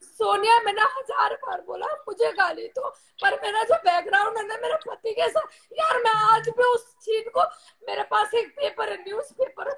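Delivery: real crying, subdued beside an outburst; tearful. Real, not acted. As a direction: confide a painful memory through tears. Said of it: "रो मत आप।". (रुंधे गले से, रोते हुए)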